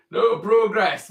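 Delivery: loud mock protest cry of no progress; mock protest shout. To the chat, playing a Luddite, a mock protest shout.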